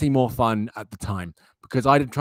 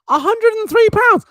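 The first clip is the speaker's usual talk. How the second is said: shocked; 6 dB louder than usual. Real, not acted. Shock at mug price.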